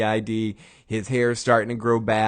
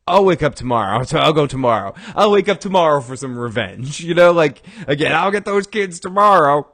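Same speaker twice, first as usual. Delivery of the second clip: blustering loud vow; 4 semitones higher, 7 dB louder than usual. Retold or imitated. Walt voiced, loud and high.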